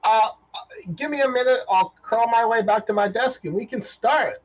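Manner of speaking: high, loud flustered robot; a startled little robot. Acted. Robot flustered by trivia news, high and loud.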